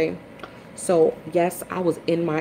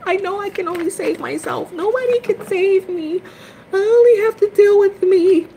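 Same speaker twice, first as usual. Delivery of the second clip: high wail; 7 dB louder than usual. Retold or imitated. High wailing declaration.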